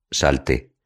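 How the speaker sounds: ordinary delivery, nothing marked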